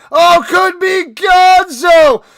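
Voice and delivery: huge high shout of amazement; touchdown shout. Acted. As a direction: scream the big play call. Touchdown call climax.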